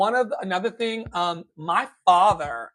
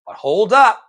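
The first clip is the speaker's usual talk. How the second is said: loud interjection; 6 semitones higher, 10 dB louder than usual. Real, not acted. Preacher-style interjection.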